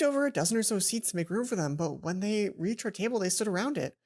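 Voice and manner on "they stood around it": plain reading voice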